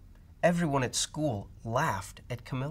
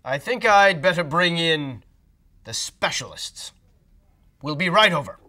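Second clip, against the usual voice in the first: loud decisive doctor; lightly voiced doctor; 5 semitones higher, 9 dB louder than usual. Doctor telling the father on phone he'll come. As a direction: promise help briskly.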